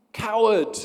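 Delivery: high, loud furious yell; an insulted boy screaming an accusation; angry scream. Acted. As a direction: hurl the insult at the mountain. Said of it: "he screams".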